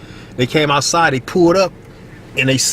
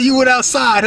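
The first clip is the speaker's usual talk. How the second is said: mama scolding; 12 semitones higher, 4 dB louder than usual. Retold or imitated. Mama catching him out.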